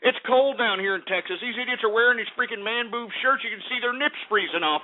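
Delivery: ordinary delivery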